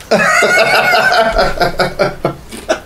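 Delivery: high burst of laughter. Real, not acted. Laughing at his own accidental joke with his co-host, a high burst. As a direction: crack up at your own joke.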